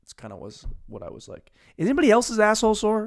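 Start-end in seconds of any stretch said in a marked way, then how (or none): 1.8-3.1 high, fast, loud question; loud blurt. Real, not acted. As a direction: blurt it to the crowd. Shock joke blurt.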